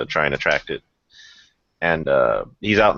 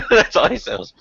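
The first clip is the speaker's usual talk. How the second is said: normal talking voice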